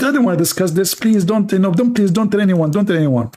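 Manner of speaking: high, anxious plea for secrecy; begging friend. Retold or imitated. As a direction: plead high not to tell. Friend begs him to keep secret.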